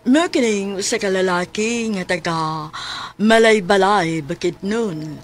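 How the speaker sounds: softly voiced story narrator; drama narration. A narrator's reading. Narrator telling listeners about Rene's life, calm storytelling. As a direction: lead the listeners into the tale.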